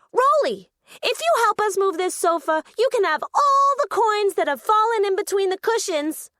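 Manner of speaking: Penelope spotting Rolly and pitching a deal, eager; excited call. Acted. Penelope calls out an offer.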